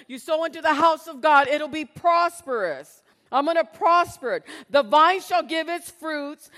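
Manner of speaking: loud, rising preacher's reading of scripture; preaching scripture. Acted. Preaching scripture at full force.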